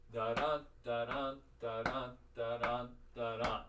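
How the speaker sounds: da-DA chanted, stress on the second beat; iambic beat. Shown as a demonstration. Teacher demonstrating the beat while walking in place. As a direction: chant the beat for them to watch. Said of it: "I'm gonna do just the sounds".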